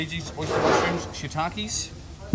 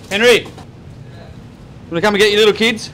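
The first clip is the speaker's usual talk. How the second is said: loud call across the room; shouting; 7 semitones higher, 10 dB louder than usual. Real, not acted. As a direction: yell it out. Shouting out to a man.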